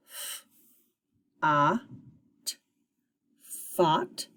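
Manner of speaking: single sounds, long gaps, then joined; sounding out. Shown as a demonstration. Teacher shows pupils how to decode a syllable, sounding out. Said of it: "Put them together".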